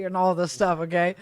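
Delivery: usual speaking voice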